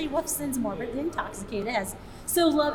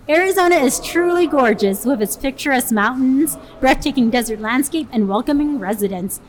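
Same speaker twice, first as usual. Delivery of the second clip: louder, storyteller true crime intro; dramatic narration; 7 dB louder than usual. Acted. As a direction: build it up like a spooky narrator. Reads the case intro dramatically.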